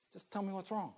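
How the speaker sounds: soft, high-pitched pleading; helpless plea. Acted. Father pleading with a crying baby, helpless plea.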